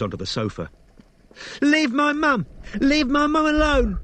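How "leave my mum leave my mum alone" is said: put-on very high, loud girl's yell; a daughter screaming to protect her mum; girl shouting. A narrator's reading. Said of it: "shouted Angel".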